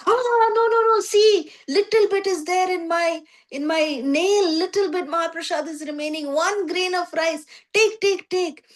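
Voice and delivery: high, hurried, apologetic offering; flustered Narada. Retold or imitated. Narada hurriedly offers leftover grain.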